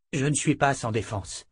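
ordinary delivery, nothing marked

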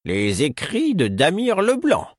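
high, slow, hushed with awe; reverent. Acted. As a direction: speak the name like a sacred text. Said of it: "avec révérence".

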